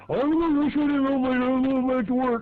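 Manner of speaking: high, loud mocking suit voice; mock the uptight office guy, pitched high; mock yuppie. Mocking the yuppies bursting off the elevator, high and loud.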